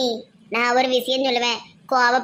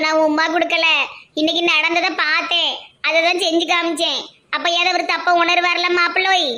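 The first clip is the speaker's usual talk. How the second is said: ordinary talk, nothing marked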